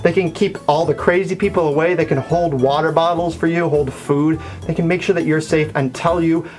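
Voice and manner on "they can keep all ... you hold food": normal talking voice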